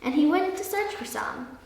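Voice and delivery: plain reading voice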